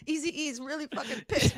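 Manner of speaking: softly, laughing a little; playful conspiratorial aside; hushed mock alarm. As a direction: confide it nervously under your breath. Acting callers conferring so [name] won't hear.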